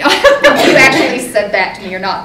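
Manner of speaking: amused, laughing aside to the guest. Real, not acted. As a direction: own up to the joke through a laugh. Joking aside during retold compliment.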